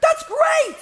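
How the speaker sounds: high, loud, laughing cheer at bad news; gleeful mock congratulations; mock excited shout. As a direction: blurt it out like it's the best news ever. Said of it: "you'd be so excited to do".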